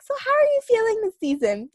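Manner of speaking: bright high imitation of a doctor's question; mimicking the allergist. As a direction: play the chirpy allergist on FaceTime.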